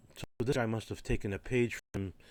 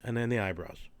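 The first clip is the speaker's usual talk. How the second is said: ordinary delivery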